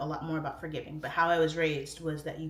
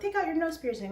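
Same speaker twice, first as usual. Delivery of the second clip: mocking advice; 11 semitones higher, 3 dB louder than usual.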